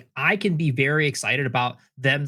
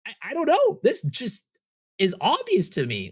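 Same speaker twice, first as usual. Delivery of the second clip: much higher, exasperated disbelief; baffled; 9 semitones higher than usual. Retold or imitated. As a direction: act baffled that anyone disagrees. Retelling own reply to angry people.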